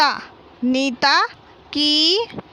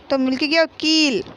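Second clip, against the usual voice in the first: ordinary talk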